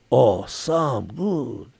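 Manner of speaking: very slow Maui Pidgin "some good". Retold or imitated. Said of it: "they'd always use the word S O M E".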